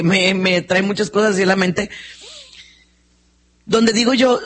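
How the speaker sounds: usual speaking voice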